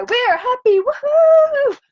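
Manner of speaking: high, loud joyful whoop; cheering whoop. Real, not acted. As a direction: throw it up like a cheerleader. Cheer climax of the chant.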